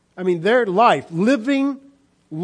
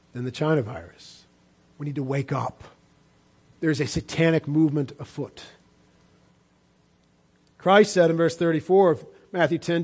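normal talking voice

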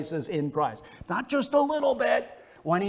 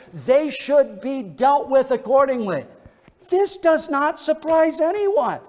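a louder, higher push of preaching; forceful preaching; 4 semitones higher, 4 dB louder than usual. Acted. Pastor presses the congregation on guilt and judgement, in forceful preaching. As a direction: drive the point home to the congregation.

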